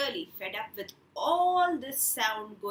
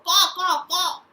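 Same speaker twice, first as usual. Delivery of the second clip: loud cawing; crow caw; 6 semitones higher, 11 dB louder than usual. Retold or imitated. Storyteller imitating a crow for children, loud caws. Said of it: "The crow will go".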